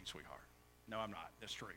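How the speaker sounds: ordinary talk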